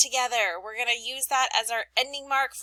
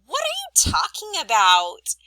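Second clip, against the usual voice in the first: asking a question; 8 semitones higher, 8 dB louder than usual. Shown as a demonstration. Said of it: "Question mark is when we ask questions".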